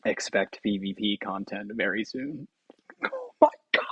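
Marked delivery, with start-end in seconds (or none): 3.0-3.8 very high burst of joy; sudden shriek. Real, not acted. Gamer squealing one last time, overjoyed. One more outburst at the end.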